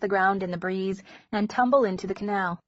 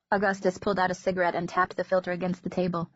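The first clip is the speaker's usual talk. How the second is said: ordinary delivery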